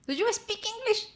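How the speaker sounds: annoyed customer. Retold or imitated. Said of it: "la señora más se enojaba".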